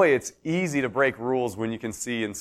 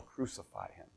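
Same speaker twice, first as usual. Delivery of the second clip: hushed crowd cry; 10 semitones lower, 21 dB quieter than usual. Retold or imitated. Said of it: "But why do they yell".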